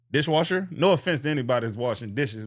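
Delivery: normal talking voice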